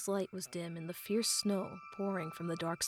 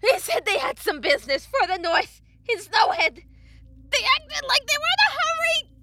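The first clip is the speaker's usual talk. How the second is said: high, loud desperate wail; sobbing screams; 15 dB louder than usual. A narrator's reading. Tortured blacksmith giving up what he knows, sobbing. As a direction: wail it out through agony. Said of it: "as he sobbed uncontrollably".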